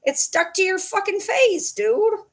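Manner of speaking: high-pitched mock exasperation; retold squealed protest to a pet; retold exasperated insistence. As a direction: insist at the dog, voice shooting way up. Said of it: "And I'm like".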